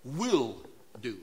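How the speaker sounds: Will isolated and punched, pause before do; stress on will. Shown as a demonstration. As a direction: split Will from do, pressing Will. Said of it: "It's emphatic".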